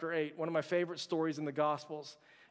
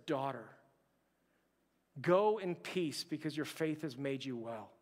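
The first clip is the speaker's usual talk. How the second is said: tender Jesus; 6 semitones lower, 5 dB quieter than usual. Acted. Pastor voices Jesus to the woman.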